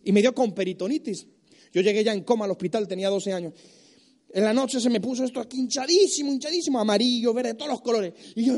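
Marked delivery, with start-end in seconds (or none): none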